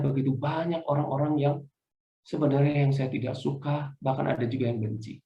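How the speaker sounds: ordinary talk, nothing marked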